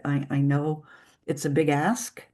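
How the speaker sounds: ordinary talk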